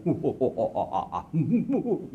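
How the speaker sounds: a drawn-out hearty laugh as an example; roaring guffaw, lightly performed; loud belly laugh. Shown as a demonstration. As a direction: demo Jibril laughing uproariously.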